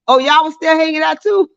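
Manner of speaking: high, laughing surprised question; real laughing disbelief; amused surprise. Real, not acted. Reacts laughing to viewers' late night.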